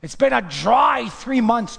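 loud, high and slow shout of relief; loud, slow triumphant cry; David exultant. Acted. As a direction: cry it out as the rejoicing king. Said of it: "David is saying".

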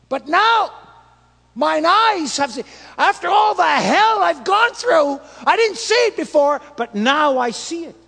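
loud, high proclamation; triumphant shout. Acted. Job declaring to the Lord he has now seen him, triumphant shout. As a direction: proclaim it like a man who finally sees.

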